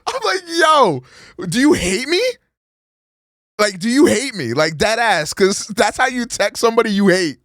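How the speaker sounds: worked-up rant. Real, not acted. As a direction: rant with outraged energy. Outraged at girlfriend's texting.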